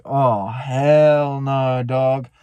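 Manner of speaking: long, stretched-out retold refusal; drawn-out dismay. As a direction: stretch the words out slowly in mock dread. Retold alarmed reaction to smoke.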